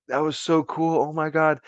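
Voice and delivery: high-pitched mock amazement; giddy retold excitement. Kids jumping up and down after the sighting, high excited quote. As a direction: re-enact kids thrilled by what they saw. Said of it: "just jumping up and down".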